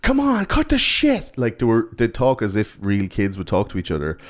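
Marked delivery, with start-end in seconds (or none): 0.0-1.4 put-on very high, slow, frantic kid voice; mock panicked kid. Kid panicking at a friend, hammed-up high panic. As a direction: squeal it like a scared kid.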